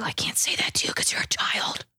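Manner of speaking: whispered aside. Retold or imitated. Gamer whispering what he can't say to a kid. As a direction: whisper it under your breath. Holding back insult to a kid.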